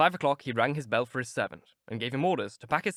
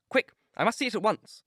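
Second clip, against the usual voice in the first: high, loud urgent exclamation; exclaiming; 10 semitones higher, 5 dB louder than usual. Acted. Dorian demands to see the dead sailor, urgent exclamation. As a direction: demand it in a rush of hope. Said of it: "he exclaimed".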